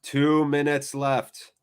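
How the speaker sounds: slow, drawn-out reminder; slow tense reminder of the time left; slow tense repeat. Real, not acted. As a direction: let each word hang.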